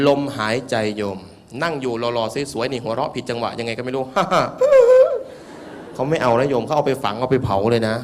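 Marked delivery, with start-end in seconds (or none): 4.1-5.9 a sudden high, loud laughing fit. Real, not acted. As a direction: burst of high laughter, much louder. Monk bursts into laughter.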